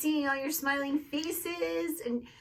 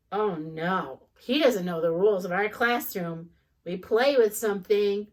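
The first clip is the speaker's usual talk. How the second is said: ordinary delivery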